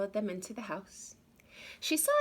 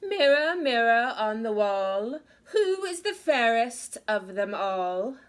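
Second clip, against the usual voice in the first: loud, proud queen calling her mirror; a vain queen demanding an answer, loud; commanding queen; 3 semitones higher, 10 dB louder than usual. Acted. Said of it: "asked the same thing".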